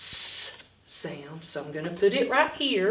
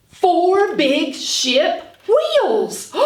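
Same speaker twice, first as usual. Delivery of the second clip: very slow, loud and higher reveal; grand reveal; 9 semitones higher, 8 dB louder, much slower than usual. Acted. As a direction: unveil it like a magic trick. Big reveal to children.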